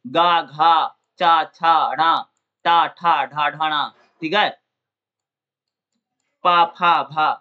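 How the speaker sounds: गा, घा, ढा sounded out one by one; voiced consonant drills. Shown as a demonstration. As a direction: voice each syllable गा, घा, भा separately. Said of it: "उनके बीच हवा में कंपन होता है".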